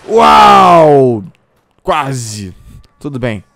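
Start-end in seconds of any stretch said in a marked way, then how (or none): 0.1-1.2 loud, drawn-out wow; amazed shout. Real, not acted. Gamer watching a near miss, loud amazed wow. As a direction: marvel out loud.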